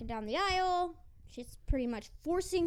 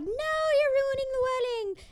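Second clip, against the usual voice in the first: much higher and louder, a crowd crying foul; hammed-up shout of wedding guests protesting; mock outraged shout; 11 semitones higher, 7 dB louder than usual. Wedding guests protest the intruder, in a mock outraged shout. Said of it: "And everyone's like".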